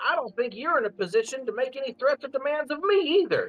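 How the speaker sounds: high, defiant little goblin; raspy defiant creature; gruff goblin voice. Acted. GM voicing Slimewell Tucket.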